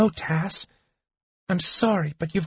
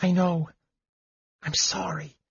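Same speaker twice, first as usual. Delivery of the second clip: soft, higher, tender apology; gentle apology; 7 semitones higher, 5 dB quieter than usual. Acted. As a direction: soothe him quietly.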